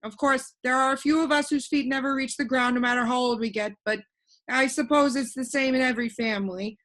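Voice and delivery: put-on a cheerful know-it-all boy floating in the air; voiced boy Alec.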